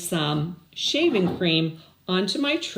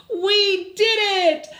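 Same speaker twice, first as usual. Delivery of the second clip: a very high, loud, slow cry of triumph; jubilant cheer; 13 semitones higher, 6 dB louder, slower than usual. Acted. Clayton and Desmond jump up and down after winning, jubilant cheer. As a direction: cheer it out like a kid who just won. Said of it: "Clayton and Desmond said, jumping up and down".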